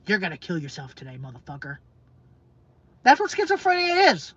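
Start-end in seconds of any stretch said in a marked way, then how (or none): 0.1-1.8 low, hushed menacing voice; sinister inner voice. Retold or imitated. Speaker voicing the schizophrenic voice, low and menacing. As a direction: voice the dark thought like a threat in your head. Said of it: "it's gotta be like".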